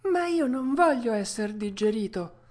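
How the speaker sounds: high puppet protest; mild tearful yell. Acted. Puppet refuses to be digested, lightly tearful high yell. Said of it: "urlò Pinocchio, ricominciando a piangere".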